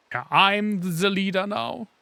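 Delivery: mock German accent, slow and high. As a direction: say it like a stiff German officer. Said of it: "For once I had to do a German accent".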